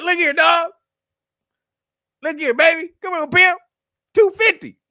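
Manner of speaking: high, loud hammed-up street-hustler offer; hustler pitch. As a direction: sweet-talk him into taking the cash. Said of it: "So he was like".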